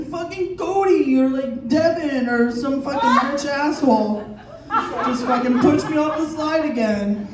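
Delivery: high, slow whiny child impression; hammed-up tearful kid; crying kid tattling. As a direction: play an upset kid telling on a bully. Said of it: "come running up to me crying".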